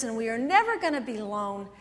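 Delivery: ordinary delivery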